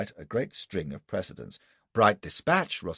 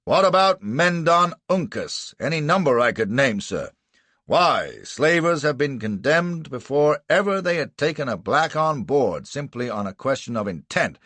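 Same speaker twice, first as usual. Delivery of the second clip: loud, higher pressing argument; bawling lawyer; 8 semitones higher, 7 dB louder than usual. Acted. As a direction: hammer the point home at full voice. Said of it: "bawls Clitheroe".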